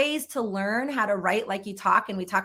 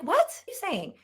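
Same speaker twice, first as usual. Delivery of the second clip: baffled reaction; 8 semitones higher than usual. Pretend. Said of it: "People would be like".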